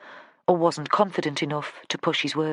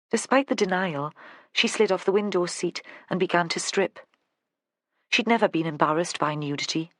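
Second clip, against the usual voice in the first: ordinary delivery, nothing marked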